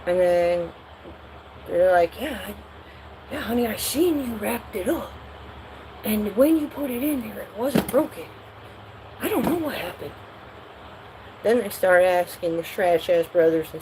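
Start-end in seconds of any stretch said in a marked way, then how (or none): 2.2-10.1 hushed parent reply. Retold or imitated. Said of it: "their little sidebar conversation".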